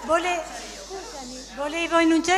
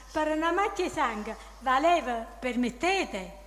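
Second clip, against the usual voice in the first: reciting verse in Neapolitan dialect; Neapolitan dialect poem. Acted. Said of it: "Dillo, dai, alza così".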